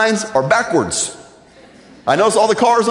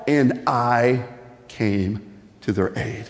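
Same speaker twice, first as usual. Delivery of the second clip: low, slow and soft; solemn hush; 6 semitones lower, 6 dB quieter, much slower than usual. Acted. God telling how he answered their cries, solemn hush. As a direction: let it sink slowly into a hush.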